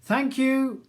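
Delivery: thanks called to the unseen jailer. A narrator's reading. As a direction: call it through the door. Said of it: "calls Charles".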